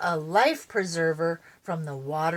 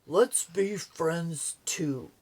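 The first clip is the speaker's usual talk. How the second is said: slow, lower animal voice; slow creature voice; 3 semitones lower, much slower than usual. Acted. Snapper offers friendship to Spunky, slow voice. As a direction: speak slowly as Snapper.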